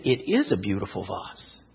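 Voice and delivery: low, soft agreement; agreeing grandfather. Acted. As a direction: drop low and quiet, gently agreeing with her. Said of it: "he looked and he said".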